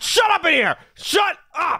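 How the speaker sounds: high, emphatic scolding yell; angry shout. Acted. Father fed up with screaming, angry high shout. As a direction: snap and order silence. Father loses patience with son.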